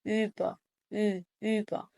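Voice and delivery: slow über with a long, rounded ü; imitated ü pronunciation. Shown as a demonstration. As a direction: model über, isolating the ü sound. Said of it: "أحاول على قد ما أقدر أقلد النطق". (تقليد نطق über ببطء مع إطالة ü)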